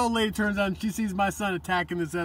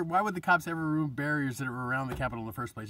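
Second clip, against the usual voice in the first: usual speaking voice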